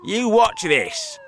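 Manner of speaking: high, loud, slow dare; bold boast. A narrator's reading. Centipede about to taunt cloud men, bold. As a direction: announce it like a show-off.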